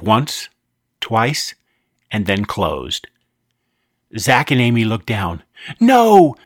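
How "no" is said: loud, high anguished yell. Acted. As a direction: scream it in horror over the dog. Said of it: "yelled Zach".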